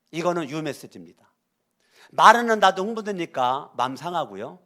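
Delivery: usual speaking voice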